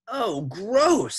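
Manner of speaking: put-on high, loud kid disgust; disgusted child. A narrator's reading. Boy sees nose fly off, high loud disgust.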